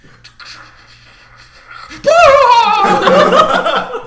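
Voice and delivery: high, loud cackling laugh; loud burst of laughter. Real, not acted. Big laugh after bit.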